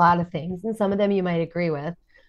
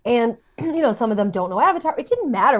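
usual speaking voice

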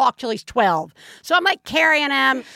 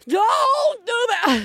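stern shout; 11 semitones higher, much slower than usual. Retold or imitated. Calling to child.

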